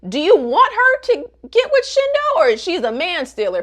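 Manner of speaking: high, loud exasperation; exasperated challenge. Real, not acted. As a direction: demand an answer, fed up.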